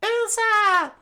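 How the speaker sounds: screamed name in high falsetto; loud falsetto shriek of a name; Vivi. Acted. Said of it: "Vivi immediately screamed".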